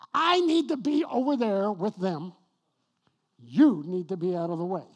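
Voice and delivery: sudden loud self-rebuke; inner voice, loud. Retold or imitated. Pastor to congregation, loud self-rebuke. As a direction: bark the realization at yourself. Realizing he is blocking traffic.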